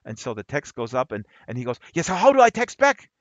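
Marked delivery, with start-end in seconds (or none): 1.9-3.1 higher, louder, keen professor voice; mock-excited professor asking how to reply; eager question. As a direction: blurt it like a thrilled newbie.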